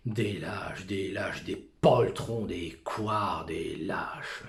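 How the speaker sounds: loud, contemptuous repetition; scornful outburst. Acted. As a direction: spit out the word lâches in disgust. Soliloquy scorning cowards.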